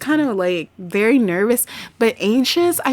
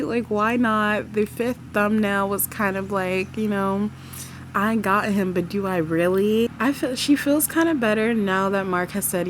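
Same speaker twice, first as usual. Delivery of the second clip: ordinary talk, nothing marked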